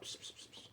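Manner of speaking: psst call. Acted. Calling someone over.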